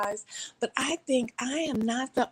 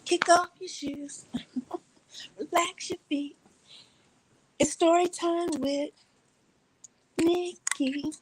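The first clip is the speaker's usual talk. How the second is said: higher, slow, dreamy chill voice; laid-back host voice; 9 semitones higher, slower than usual. Retold or imitated. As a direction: drawl it like a lazy host. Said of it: "And he's like".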